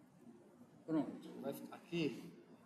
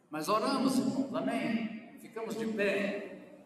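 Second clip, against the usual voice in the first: rallying call; 7 semitones higher, 5 dB louder, slower than usual. Real, not acted. Rallying congregation after prayer.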